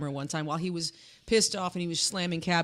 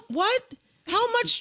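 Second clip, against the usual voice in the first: incredulous; much slower than usual. Real, not acted. Incredulous rant about recordings.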